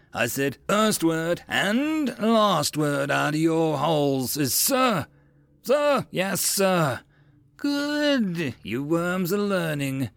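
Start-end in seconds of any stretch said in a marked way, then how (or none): 0.7-5.1 high, slow, hammered-out order; slow parade-ground shout; drill officer's shout. A narrator's reading. Officer repeats rule angrily. 5.6-7.0 high-pitched military reply; raised obedient answer; recruits' response. A narrator's reading. Recruits answer drill officer. 7.6-10.1 high, drawn-out mock approval; grinning. A narrator's reading. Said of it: "Arvin grinned".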